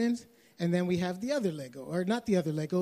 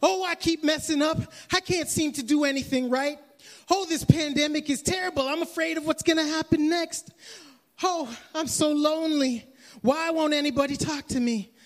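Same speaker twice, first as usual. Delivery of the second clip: mock self-pity; 8 semitones higher than usual. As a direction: mimic a complaining believer feeling sorry for himself. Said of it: "You'll be like".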